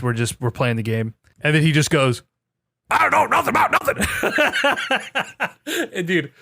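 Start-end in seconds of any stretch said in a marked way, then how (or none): 2.9-4.0 squeaky, rushed cartoon villain voice, much higher; a deliberately awful Strong Bad; horrible Strong Bad impression. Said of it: "horrible strong bad impression". 4.1-5.9 high laugh. Real, not acted. Laughing at own impression. 5.9-6.3 laughing. Real, not acted. Still laughing.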